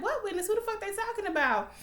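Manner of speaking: high, disbelieving mock reaction; incredulous. Reacting to the ADA's claim, high and incredulous. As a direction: act baffled and outraged. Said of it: "I'm like".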